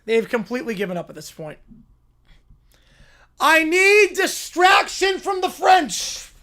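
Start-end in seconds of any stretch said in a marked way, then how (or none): 3.4-6.3 high, drawn-out character shout; acted shouting. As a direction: yell it slowly and dramatically. Acted script reading, character yells.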